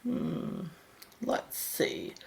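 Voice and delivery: ordinary talk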